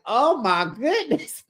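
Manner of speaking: high, drawn-out mock astonishment; shocked reaction. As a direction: stretch it out high, scandalized. Speaker's acted shocked reaction.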